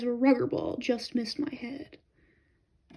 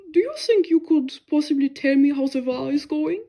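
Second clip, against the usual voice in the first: lightly voiced monk, politely curious; a hint of an old foreign monk asking gently; German monk; 3 semitones higher, 7 dB louder than usual. A narrator's reading. Monk asking the soldier for war news, lightly voiced and polite.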